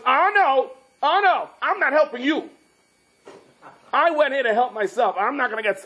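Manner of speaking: high-pitched, indignant acted rant; exasperated refusal. Man refuses to help the maiden, fed-up high rant. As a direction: protest high, fed up with everyone.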